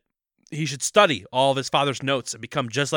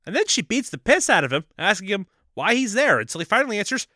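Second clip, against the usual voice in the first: ordinary talk, nothing marked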